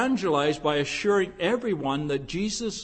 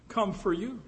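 normal talking voice

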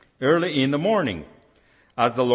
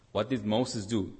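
usual speaking voice

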